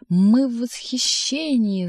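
high, drawn-out wailing howl; howling cat. A narrator's reading. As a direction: stretch the vowels into a slow cat's yowl. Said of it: "завывал кот". (протяжное кошачье завывание)

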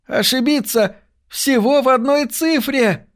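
very high, amazed outcry; excited exclamation. A narrator's reading. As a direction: exclaim at an incredible near miss, pitch held high. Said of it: "воскликнул О'Лири". (изумлённый возглас высоким голосом)

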